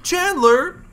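loud, high sitcom-character call; hammed-up, high-pitched exclamation; Ross. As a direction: call a friend's name in a high impression voice. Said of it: "trying to think of Ross".

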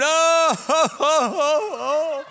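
loud, high mourner's wail; a long, loud, high keening "Oh"; imitated wailing. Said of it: "and he's screaming".

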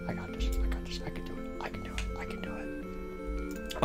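barely audible nervous whisper; whispered pep talk. Acted. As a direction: whisper to yourself to build up courage.

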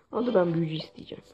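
fast, breathy whispered aside; hurried unvoiced whisper; quick whisper. Real, not acted. Quick aside while playing.

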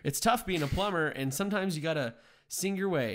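mock Mario line. Jokes a musical Mario line.

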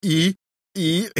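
can't get words out for giggling; breaking into laughter. Acted. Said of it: "zataczał się ze śmiechu".

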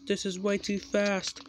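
high-pitched alarm; panicked cry. A narrator's reading. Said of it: "cried James".

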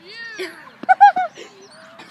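high burst of laughter; loud laughing fit. Real, not acted. Kid cracks up at his friend's dance, laughing loud.

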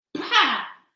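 a sharp, loud high "Pah!"; shouted scoff. A narrator's reading. Cappuccetto Blu scoffing at the wolf, loud shout. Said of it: "gridò Cappuccetto Blu".

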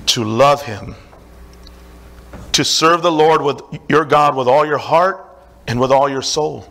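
low, measured reading from the Bible; scripture reading. Acted. Preacher reads Deuteronomy ten to the church, solemn reading. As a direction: read the verses like a solemn lesson.

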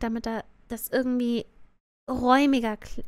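usual speaking voice

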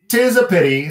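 high, loud Irish lilt; a pitying little brogue; Irish leprechaun. Acted. Leprechaun O'Leary to Michael, Irish lilt.

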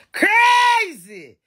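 high, loud shout on crazy. Real, not acted. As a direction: belt out crazy at the top of the voice.

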